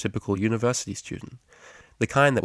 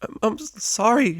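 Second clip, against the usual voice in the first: much higher, stammering little squeak; 14 semitones higher than usual. A narrator's reading. Scared mare apologizes to DJ, squeaking. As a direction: shrink back with a tiny squeak. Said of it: "she squeaked".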